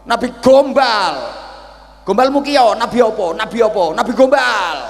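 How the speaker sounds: high, loud furious insults; cursing old man. Acted. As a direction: spit the insults at him. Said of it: "Misuh-misuh". (kakek tua memaki-maki dengan marah)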